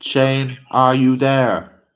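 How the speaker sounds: calling out. Real, not acted. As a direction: call out slowly to someone who may not hear. Calling out to absent friend.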